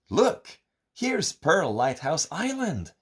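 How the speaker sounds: high, eager little-creature voice; excited Goody. A narrator's reading. Goody showing Gooda the island on the map, high and eager. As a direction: point it out with delight. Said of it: "says Goody".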